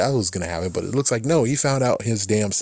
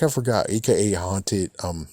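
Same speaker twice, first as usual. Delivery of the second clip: ordinary talk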